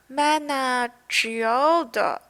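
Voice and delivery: "Mana Geode" sounded out very slowly; unsure pronunciation. Shown as a demonstration. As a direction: feel your way through "Mana Geode" syllable by syllable. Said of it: "don't know how to pronounce it".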